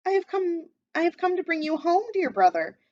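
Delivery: a small sister coming to fetch her brother; different little girl voice. A narrator's reading. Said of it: "I'll do a different one".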